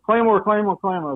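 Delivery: mild retold shout of the warning; retold call-out. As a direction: call the warning out before the blast. Private calls out before detonation.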